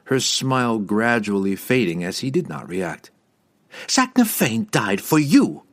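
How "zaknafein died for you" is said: loud sudden accusation; sudden cry. Acted. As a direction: cry it out to wound him. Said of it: "Verna cried suddenly".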